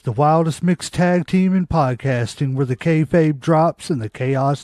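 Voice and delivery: loud, punchy show-opening announcer. Acted. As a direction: hype up the crowd like a ring announcer. Podcast opening hype intro.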